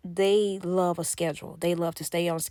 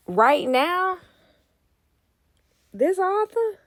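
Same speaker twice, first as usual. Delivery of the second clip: higher and louder, bursting with excitement; excited gushing; 9 semitones higher, 7 dB louder than usual. Real, not acted. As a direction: rave about the author you love. Gushing over a favourite author.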